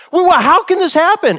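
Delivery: indignant protest in the courtroom, pitched high; outraged. Retold or imitated. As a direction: burst out in disbelief at the verdict. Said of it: "You're like".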